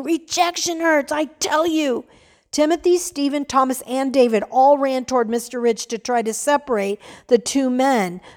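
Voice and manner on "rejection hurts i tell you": screaming. Acted. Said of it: "He was screaming".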